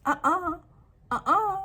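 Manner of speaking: high, loud "uh-uh"; emphatic refusal. Real, not acted. Refusing to trust them with wealth, emphatic. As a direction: shut it down with two sharp no's. Rejects the gullible trust just acted.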